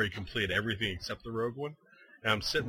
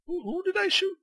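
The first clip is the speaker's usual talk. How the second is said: retold confusion; 6 dB louder than usual. Retold confusion over shooting stranger.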